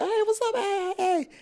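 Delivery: very high-pitched hollered hello; squealed, playful mock greeting; excited greeting. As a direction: imitate a room of friends greeting each other. Everybody greeting each other at audition.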